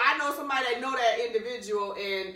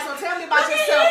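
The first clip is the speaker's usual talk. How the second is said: very high, loud swooning come-on; gushing flirtation; 11 dB louder than usual. Acted. Woman acting out flirting with a man with a Southern accent, giddy and gushing. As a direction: squeal it like a smitten flirt.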